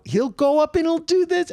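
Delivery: high, pushed performer voice; over-selling stage voice. Shown as a demonstration. Comic demonstrates stage delivery to avoid, high selling voice. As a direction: push it up high to sell the joke. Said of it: "and he talks like that".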